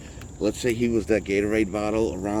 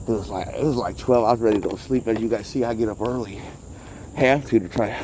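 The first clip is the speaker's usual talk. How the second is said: usual speaking voice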